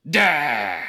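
loud imitated "dah" outburst; mock villain exclamation, loud and open-throated; villain's verbal tic. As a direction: blurt out a campy villain's drawn-out "dah". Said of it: "he goes".

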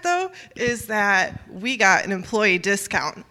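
ordinary talk

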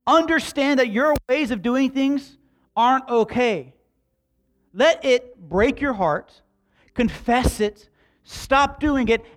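forceful exhortation with drawn-out words; impassioned preaching. Real, not acted. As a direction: press each command on the congregation, pitched high. Preaches the passage at full force.

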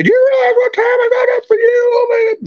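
very high shrill mother impression; high mocking impression of a complaining mother; nagging mom. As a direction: whine it like a martyr mother. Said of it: "she would make me hear it every day".

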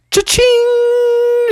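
very high sung cha-ching; cash register sound. Acted. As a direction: ring up the money. Monster shows money.

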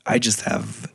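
hoarse, half-lost voice; raspy whisper. Real, not acted. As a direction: strain through a lost voice. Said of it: "partial voice now".

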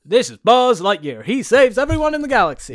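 loud, high, soaring announcement; heroic toy introduction. Acted. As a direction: proclaim a space hero to a child. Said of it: "he gets to Buzz and he goes".

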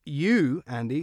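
ordinary talk

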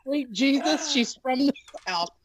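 high-pitched mock dismay; mock astonishment. Acting out people hearing a Southern phrase, in mock astonishment. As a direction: exclaim it as a scandalized onlooker would. Acts out people's shocked reaction.

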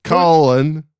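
put-on botched English accent, first word loud; unplaceable bad accent. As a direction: mangle an English accent, slowing on the subtitle. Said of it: "I don't know where that guy's from".

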